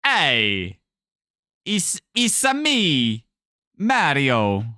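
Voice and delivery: hammed-up Italian catchphrase; Mario. Shown as a demonstration. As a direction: put on a drawn-out Italian cartoon voice.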